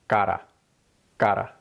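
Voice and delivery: cara with a single tapped r. Shown as a demonstration. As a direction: cara said like catta. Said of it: "stick it into a Spanish word".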